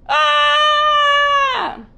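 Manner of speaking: high, loud yelp of a warthog flying on a vine; swinging yell. Acted. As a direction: let out a big, high falling-in whoop. Pumbaa swoops in on vine.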